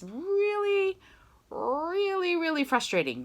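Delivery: "really" stretched out high and long; high, drawn-out "really", sliding back down; emphatic frustration. Real, not acted. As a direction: drag out each "really" before "frustrating". Teacher shares her own frustration.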